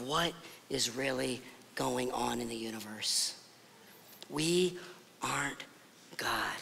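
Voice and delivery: soft, low, word-by-word sermon point; hushed preaching. Acted. Pastor to congregation, soft low deliberate emphasis.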